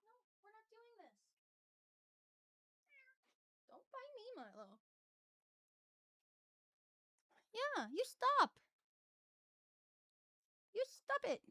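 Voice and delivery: soft, distant scolding of a biting cat. Real, not acted. Streamer scolding her cat Milo away from the mic, softly. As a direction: quietly tell the cat off, high and away from the mic.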